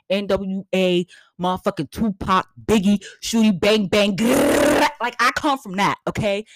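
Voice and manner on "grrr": mock gun growl. Imitating gunfire sound after rapper names.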